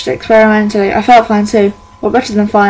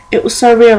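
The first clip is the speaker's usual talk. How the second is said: usual speaking voice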